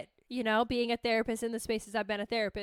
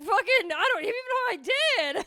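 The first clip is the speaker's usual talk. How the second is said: high, louder, indignant; defiant outburst; 11 semitones higher, 4 dB louder than usual. Retold or imitated. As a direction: refuse outright and indignantly. Retelling her own defiant reaction.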